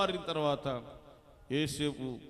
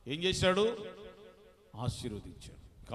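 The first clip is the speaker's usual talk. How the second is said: ordinary delivery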